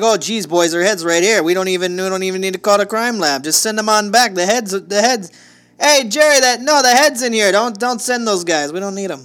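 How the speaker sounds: loud, high Midwest cop voice; hammy Midwestern small-town cop; Midwest accent. Retold or imitated. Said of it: "It was a terrible Midwest accent".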